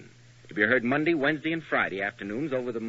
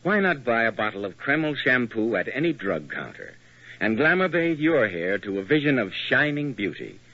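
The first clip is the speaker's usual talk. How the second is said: radio ad read; 3 semitones lower, 4 dB louder than usual. Acted. Announcer closes the shampoo ad.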